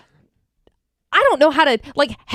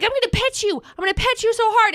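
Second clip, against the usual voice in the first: higher, teasing mock-menace; playful high threat; 9 semitones higher than usual. Ghost owner running at her dog, playful high mock threat. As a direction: chase the dog with silly glee.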